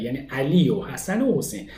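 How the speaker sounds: ordinary talk, nothing marked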